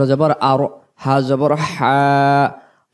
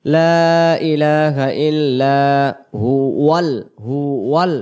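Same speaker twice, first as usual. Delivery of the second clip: ordinary delivery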